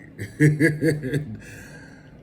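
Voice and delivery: loud amused laugh; hearty laugh. Real, not acted. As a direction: let out a big laugh. Laughs after "hey.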